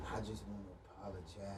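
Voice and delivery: mock meek apology. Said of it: "playing the ex apologizing".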